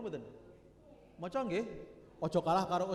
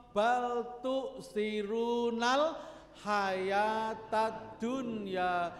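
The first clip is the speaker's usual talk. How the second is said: very slow, measured Arabic recitation; Quran recitation; 4 dB louder, much slower than usual. Real, not acted. Preacher citing a verse on choosing the world, slow drawn-out recitation. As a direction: stretch each syllable of the verse.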